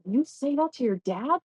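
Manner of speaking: put-on high, incredulous kid voice; shocked friends. Friends stunned by what she tells her parents, high and incredulous. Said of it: "my friends would go".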